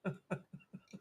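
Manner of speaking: soft unvoiced giggle; quick airy laugh; breathy giggle. Real, not acted. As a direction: giggle at her own silliness. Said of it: "I'm silly".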